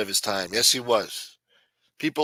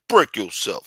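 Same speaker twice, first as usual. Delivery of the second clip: louder, snappy gangster threat; mock stick-up command; West Coast gangster; 6 dB louder than usual. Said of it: "West Coast gangster shit?".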